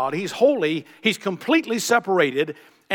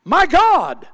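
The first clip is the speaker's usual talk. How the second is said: high, loud cry of anguish; a loud, high, anguished call to God; 10 semitones higher, 6 dB louder than usual. Acted. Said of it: "he cries".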